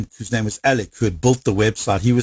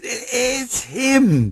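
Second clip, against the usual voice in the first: high, drawn-out disbelieving gasp of recognition; stunned announcement; 12 semitones higher, much slower than usual. Retold or imitated. Wife tells husband who is on the phone, stunned. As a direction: stretch it out high, awestruck.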